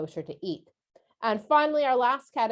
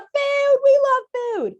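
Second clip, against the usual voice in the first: much higher, louder gleeful food voice; excited squeal; 8 dB louder, slower than usual. Acted. Teacher hypes food to the kids in a high squeal. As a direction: squeal it like food is the best thing ever. Kids' lesson, playful hype.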